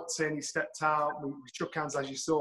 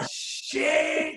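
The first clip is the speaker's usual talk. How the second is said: startled curse; 11 semitones higher than usual. Retold or imitated. Lift opens on Tyson.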